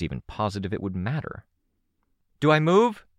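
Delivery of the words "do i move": high, loud battlefield query; urgent shouted question. A narrator's reading. Daughter asking parents for orders mid-fight, urgent shout.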